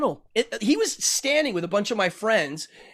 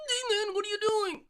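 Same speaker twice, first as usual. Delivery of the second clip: much higher voice, seemingly from inside the cup; thrown voice; 14 semitones higher, 6 dB quieter than usual. Shown as a demonstration. Ventriloquist demonstrating voice throwing for the host, higher and from the cup. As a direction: throw the voice so it seems to come from the Dracula cup. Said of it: "So the voice throwing trick".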